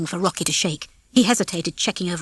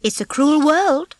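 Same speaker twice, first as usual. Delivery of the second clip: shrugging; 7 semitones higher, 4 dB louder, slower than usual. Acted. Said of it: "Ginger said with a shrug".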